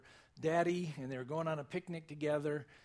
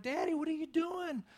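put-on much higher, frightened little-girl voice; imitated freaking out; 10 semitones higher than usual. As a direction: act out a child in a panic. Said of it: "and she's freaking out".

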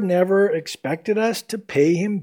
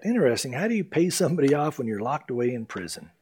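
ordinary talk

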